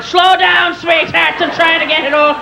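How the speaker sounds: put-on emphatic father's Irish accent; thick Irish brogue. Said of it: "He's got a thick brogue".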